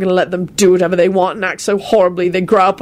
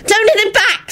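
high-pitched pleading wail; frantic, high entreaty; desperate wail. Acted. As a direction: cry out to stop a fight. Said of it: "Solange wailed desperately".

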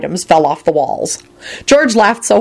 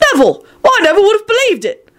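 very high, astonished exclamation; incredulous; faster than usual. A narrator's reading. Arthur shocked at Neville, incredulous. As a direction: exclaim in high-pitched disbelief. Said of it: "screamed incredulously".

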